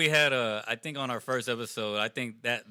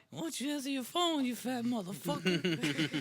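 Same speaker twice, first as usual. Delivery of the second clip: soft, high old-man impression; cartoon old man cursing; 9 semitones higher, 8 dB quieter than usual. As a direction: cuss him out quietly, dragging the last words. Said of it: "he starts cursing him out".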